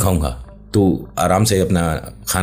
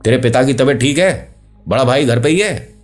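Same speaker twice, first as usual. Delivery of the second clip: calling out; 6 semitones higher than usual. Acted. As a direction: shout the order to go home. Uncle calling to boy.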